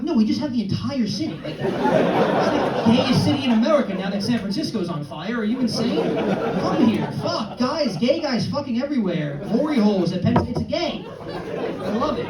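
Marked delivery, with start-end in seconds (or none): 0.0-11.0 high, loud incredulous rant; mock outrage, voice climbing high and loud; exasperated rant. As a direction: go off at him, disbelieving. Comedian's exasperated retort to friend.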